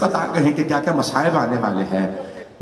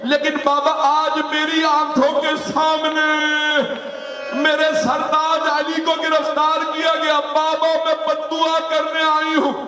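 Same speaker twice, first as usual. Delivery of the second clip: much higher, slow, crying out to her father; keen it like a grieving daughter at a grave; wailing lament; 12 semitones higher than usual. Acted. Preacher voicing Fatima crying to her father, high wailing lament.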